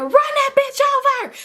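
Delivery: very high-pitched screamed threat; retold screaming. Ranting to cousin on the phone, high retold scream. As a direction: imitate screaming, voice shooting way up. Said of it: "I was really just screaming".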